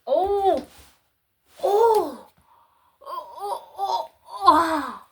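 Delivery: high, excited string of ohs; high-pitched gleeful surprise; giddy ohs. Real, not acted. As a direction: break into delighted laughing ohs. Spots a longed-for find.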